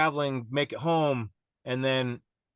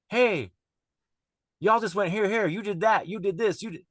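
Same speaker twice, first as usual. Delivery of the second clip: excited; 6 semitones higher than usual. Retold or imitated. Telling friends what they did.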